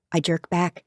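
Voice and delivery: usual speaking voice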